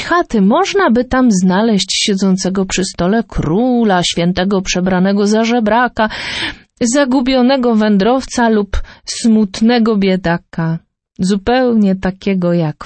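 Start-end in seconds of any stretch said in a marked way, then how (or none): none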